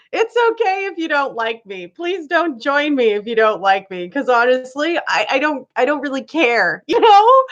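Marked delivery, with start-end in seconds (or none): none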